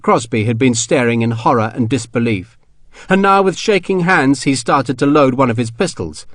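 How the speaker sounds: plain reading voice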